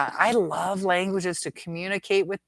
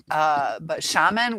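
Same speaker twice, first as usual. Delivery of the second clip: normal talking voice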